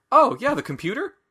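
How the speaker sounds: Doolittle, casual. Retold or imitated. Doolittle casually revealing the password, acted breezy and high. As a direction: toss it off as if obvious, hammed up. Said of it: "Doolittle just casually says".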